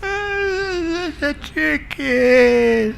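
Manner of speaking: high, very slow crying whine; hammed-up wail on the edge of tears; tearful mock wail. Wife about to burst into tears, drawn-out high wail. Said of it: "her eyes went all watery".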